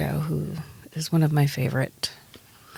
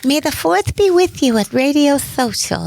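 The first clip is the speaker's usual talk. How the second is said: high lisping boxer voice; Mike Tyson impression; 9 semitones higher than usual. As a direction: lisp it out like a boxer. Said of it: "I was trying to sound like Mike Tyson".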